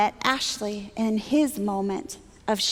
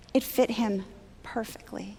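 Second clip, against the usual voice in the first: near-whisper, much softer; hushed awe; 3 semitones lower, 8 dB quieter than usual. Real, not acted. Telling how the bracelet fit his wrist, hushed. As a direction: drop to a whisper for the marvel.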